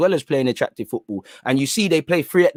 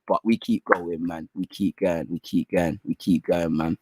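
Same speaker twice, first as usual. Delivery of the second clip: trailing off, softer each time; fading murmur; 9 semitones lower, 6 dB quieter, slower than usual. Real, not acted. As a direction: let it fade into a mutter. Fading repeated mantra.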